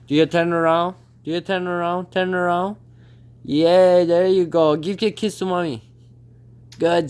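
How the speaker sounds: high, sing-song pet praise; high cheerful coaxing to the bird; pet talk. Real, not acted. Training the parrot.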